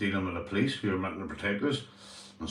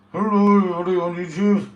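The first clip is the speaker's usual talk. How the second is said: high, loud cheeky puppet greeting; 7 semitones higher, 9 dB louder than usual. Acted. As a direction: throw a squeaky dummy voice at the camera. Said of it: "He speaks to, he speaks".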